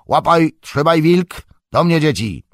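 high, loud calling of dogs; loud shout. A narrator's reading. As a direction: bellow it to rally the dogs. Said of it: "donośny głos krzyknął". (głośny, wysoki okrzyk)

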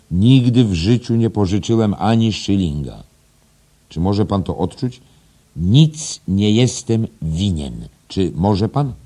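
high, loud, emphatic declaiming; proud schoolmaster. Acted. Headmaster challenging teacher to feel debt-free, loud and proud. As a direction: boast weightily, then challenge him. Voices Mr Deasy in story.

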